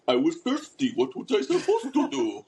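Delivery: loud, high mock French-or-Polish accent; hammed-up European accent, amused and drawn out; imitated European accent. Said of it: "he describes it as a French accent".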